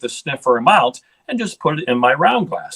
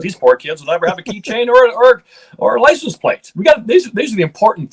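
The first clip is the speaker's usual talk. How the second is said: climbing higher and louder in playful indignation; rising mock outrage; 10 semitones higher, 4 dB louder than usual. Real, not acted. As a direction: get worked up, half joking, pushing the pitch way up. Mock outrage over soft baby names.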